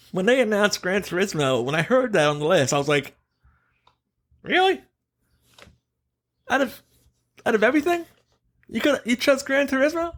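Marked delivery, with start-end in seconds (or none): 4.4-10.1 high-pitched retold disbelief, rising questions; incredulous. Co-host retells hearing Gran Turismo was on the list, incredulous. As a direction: replay your baffled reaction, pitch way up.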